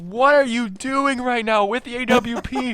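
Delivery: screaming in disbelief, high; excited yell. Real, not acted. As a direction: hype caster losing it. Caster screams at the clutch.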